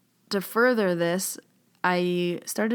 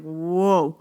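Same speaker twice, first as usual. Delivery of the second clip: louder, jolted whoa; startled whoa; 6 dB louder than usual. Retold or imitated. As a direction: recoil as if hit by a wave. Sudden wave of nausea.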